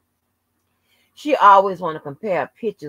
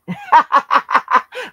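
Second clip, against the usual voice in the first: loud, high cackling laugh; 10 semitones higher, 6 dB louder than usual. Real, not acted. Teasing a rival about a song, loud high laugh.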